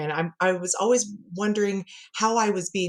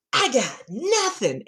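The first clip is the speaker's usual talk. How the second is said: put-on higher, slow, drawn-out kid's announcement; gleeful mock ta-da delivery; proud little boy; 5 semitones higher, slower than usual. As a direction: imitate a child showing off triumphantly. Imitates son's proud announcement.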